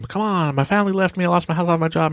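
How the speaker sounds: high, pleading mock panic; hammed-up despair; mock freaking out. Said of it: "he's freaking out".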